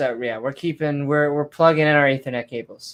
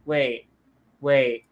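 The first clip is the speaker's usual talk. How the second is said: normal talking voice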